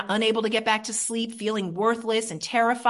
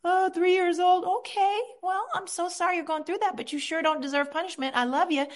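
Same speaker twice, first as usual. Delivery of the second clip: loving parent voice; 8 semitones higher than usual. Shown as a demonstration. Comforting her three-year-old inner part, high and tender. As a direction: comfort a small child gently. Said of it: "I talk to my parts like this".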